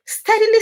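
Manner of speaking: high and loud "Steadily"; emphatic. Real, not acted. Emphatic build-up.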